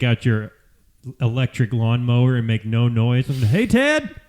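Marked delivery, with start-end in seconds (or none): 3.4-4.1 high, slow, chummy neighbor call; hammed-up neighbor. Neighbor calls to Ted over the fence, high and chummy.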